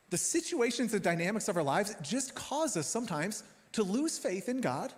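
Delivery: normal talking voice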